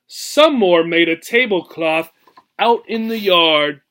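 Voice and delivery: lively storybook read-aloud, rhythmic and bouncy; expressive picture-book reading; reading to children. Acted. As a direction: read the rhyme to a class with relish. Teacher reads picture book aloud.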